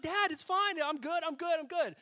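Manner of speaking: high-pitched mock giggly voice; imitated son squeaking that all's well; amused reassurance. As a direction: wave dad off while acting tickled. Amused son reassuring his angry dad.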